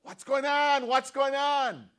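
high, very loud blind man's shout; loud, high-pitched calling out. Acted. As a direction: yell it out to anyone passing. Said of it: "so he began to shout".

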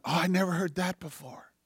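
soft but lifted wonder; quiet, dawning surprise; retold amazement. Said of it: "I just go".

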